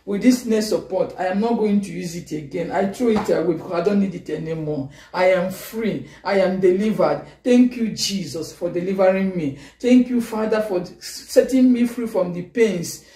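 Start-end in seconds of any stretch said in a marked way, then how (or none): none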